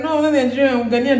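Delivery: high, loud retold protest; insistent correction. Correcting workers about being Ghanaian, insistent retold protest. Acts out own correcting reply.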